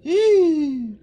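sharp high frightened gasp; startled high intake of breath; scared gasp. Real, not acted.

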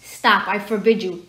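mild mock yell, drawn out slowly; understated, slow panicked order. A narrator's reading. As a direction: forbid it with a touch of panic. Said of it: "yelled Uncle Vernon in panic".

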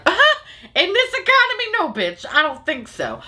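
high laughing mock voice, then a dismissive no; a laughing, high-pitched sassy brush-off; sassy refusal. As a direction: scoff it off with a laughing high squeak. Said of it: "I was like".

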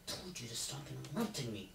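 hushed scolding. Acted. Scolding puppet under his breath.

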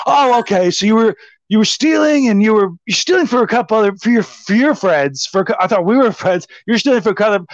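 high-pitched mock understanding; retold sarcastic indignation. Host to thieving houseguest, high retold sarcastic indignation.

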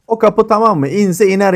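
eager and hopeful; excited question. Real, not acted. Excited check during siege. (heyecanlı soru)

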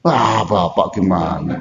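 ordinary talk, nothing marked

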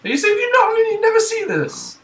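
high, loud disbelief; can't believe you never saw it; incredulous. Real, not acted. Disbelief friend never saw film.

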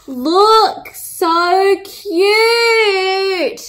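high, loud, sing-song cooing; gushing. Real, not acted. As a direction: squeal over something adorable. Delighted by the bowl.

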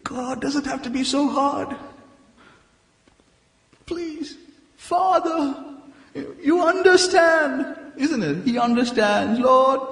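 high, pleading whine to the Father; pleading with God. Retold or imitated. Preacher mimics his younger self begging God. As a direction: beg God in a high, soft voice.

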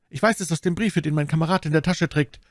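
high anxious explanation; pleading high voice; frightened bandit. Acted. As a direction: answer nervously as the captive. Frightened captive explains himself.

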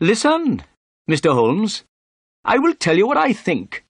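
high-pitched, pompous inspector; self-important. A narrator's reading. Inspector cutting off the detective to give his theory, self-important. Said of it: "he said importantly".

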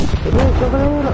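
high, loud insistent callout; urgent shout. Real, not acted. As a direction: yell it so they finally look. Insisting enemy position to teammate.

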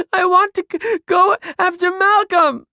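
put-on very high, loud, breath-catching child's wail; hysterical child. A narrator's reading. Ruby flinging herself away, hysterical child. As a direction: stammer it out through panicky gasps. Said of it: "The girl was hysterical".

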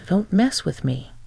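ordinary delivery, nothing marked

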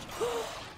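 sharp high gasp; panicked gasp. Real, not acted. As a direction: gasp in sudden alarm. Panic while failing to recharge.

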